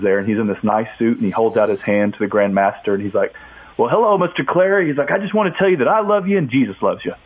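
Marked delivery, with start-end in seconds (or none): 3.8-7.1 warm greeting. Retold or imitated. Reverend greeting Klan leader warmly.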